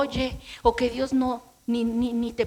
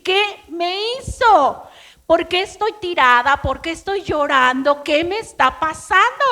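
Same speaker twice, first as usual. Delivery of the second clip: higher, louder, distressed questions; shocked bewilderment; 4 semitones higher, 6 dB louder than usual. Retold or imitated. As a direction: cry out in confused alarm. Cae llorando sin entender. (desconcierto alterado, más agudo y fuerte)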